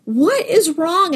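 high, drawn-out mock bewilderment; stretched-out, high-pitched retold question; baffled husband. Clueless husband finds wife sobbing.

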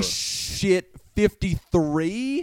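stunned disbelief. Real, not acted. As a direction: stretch it out like you can't believe the number. Hearing the big count.

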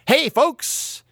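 big mock showbiz hello; vaudeville showman. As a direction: sell it like a jazzy stage host. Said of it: "doing kind of a vaudeville jazzy, like".

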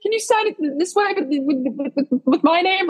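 high, stumbling, flustered asking; nervous stammer. Retold or imitated. Asking Olivia to sign, high flustered stammer. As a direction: fumble the words, flustered. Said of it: "that's when I was starting to get nervous".

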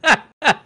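high, loud burst of laughter. Real, not acted. Laughs at bacon.

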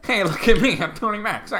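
high, giggling put-on voice; laughing mock boast. Friend joins the Tony Max bit, laughing. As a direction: brag through laughter. Mocking the disguised celebrity.

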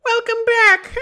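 shrieking witch welcome. Acted. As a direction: cackle the greeting like a witch. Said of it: "That's more like a witch".

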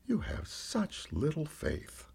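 slow, soft, gently let down; a little disappointed. A narrator's reading. Jesus gently chides his disciple, a little disappointed. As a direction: say it softly and slowly with mild disappointment. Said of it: "sounded a little disappointed".

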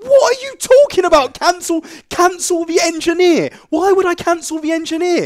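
very high, full-blown shouted disbelief; furious retort. Retold or imitated. Customer told he could cancel the engineer, exploding in hammed-up fury.

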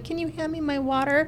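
high, helpless patient voice; high whiny mock request. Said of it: "They're like".

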